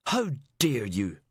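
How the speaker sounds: slow, drawn-out indignation; acted offended fury; indignant outrage. A narrator's reading. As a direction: storm in outraged. Storms in on the inspector.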